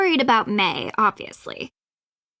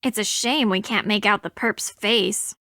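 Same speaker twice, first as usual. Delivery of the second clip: normal talking voice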